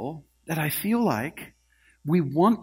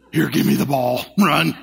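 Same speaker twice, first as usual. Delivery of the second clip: louder, bossy mock player; mock demand; 7 dB louder than usual. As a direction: bark for the ball. Footballer demanding the ball.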